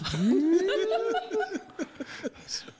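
big high laugh; high burst of laughter. Real, not acted. Laughs at own posh line.

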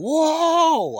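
a high, loud yelp of alarm at a charging dog; startled holler. Retold or imitated. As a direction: shout it out high and loud, caught off guard. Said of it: "I probably even hollered".

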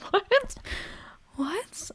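high-pitched laughing disbelief; incredulous squeak. Retold or imitated. Retelling reaction to friend's insult, mock incredulous.

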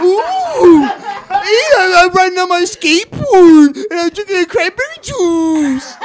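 high, loud, drawn-out fake Mexican accent; mock Mexican accent. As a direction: shout it slowly in a hammed-up Mexican accent. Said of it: "doing the most authentic accent".

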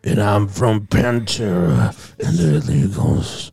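[name]. Shown as a demonstration. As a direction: draw it out like a swaggering metal frontman. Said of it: "Evan started doing an impression of [name]".

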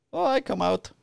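high-pitched mock Polish accent; Polish guy. Polish guy answering police knock on door, mimicked high accented voice. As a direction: put on the Polish man's high voice. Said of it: "get this Polish guy".

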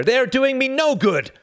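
a desperate president crying out; despairing cry. Acted. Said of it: "cried Bennis in despair".

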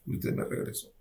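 whispered, barely voiced repeat; hushed prayer. Patient praying to the Lord at night, whispered. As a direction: whisper it to God under your breath.

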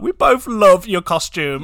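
high, loud, drawn-out gush; slow, over-the-top praise edged with laughter; gushing compliment. Retold or imitated. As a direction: coo loudly over a child's outfit. Retold gushing reply to the boy.

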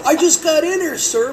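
high, loud pleading protest; indignant protest. Retold or imitated. Accused by the store owner: high loud protest. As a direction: plead your innocence loudly.

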